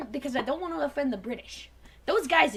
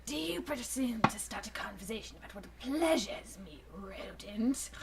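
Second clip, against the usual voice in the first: Dinobot whisper; 6 semitones lower, 11 dB quieter than usual. Acted.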